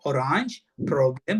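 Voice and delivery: slow "orange" and "problem" with a round German O; teacher slowly shows the O sound in "orange" and "problem"; o sound shown. Shown as a demonstration. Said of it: "ఓనే పలకాలి అక్కడ".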